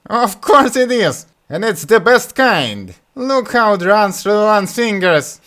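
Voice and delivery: high, loud, slow glee; delighted Butthead. Acted. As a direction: gush over the mud. Butthead defends the mud.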